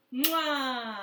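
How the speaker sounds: big blown kiss; loud smacking kiss sound. Acted. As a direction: blow a loud smooch. Blowing a kiss.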